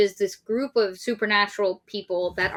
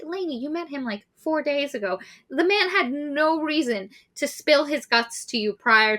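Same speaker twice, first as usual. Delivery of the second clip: exasperated reply; 7 semitones higher than usual. Retold or imitated. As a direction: talk her down like a weary aunt. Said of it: "And I'm like".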